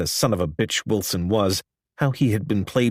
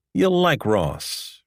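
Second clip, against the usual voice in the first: put-on soft Southern accent; 6 semitones higher, much slower than usual. A narrator's reading. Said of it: "in his soft Southern accent".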